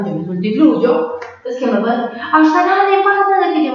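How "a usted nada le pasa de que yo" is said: high, loud mimicry of mom; raised, high-pitched mock reproach; retold angry mother. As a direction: imitate mom cutting in indignantly.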